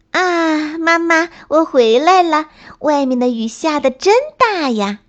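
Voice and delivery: put-on high-pitched child bunny calling to mum; little rabbit's high, excited homecoming. A narrator's reading. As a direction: burst in calling out, high and eager. Little rabbit bursts home from rain.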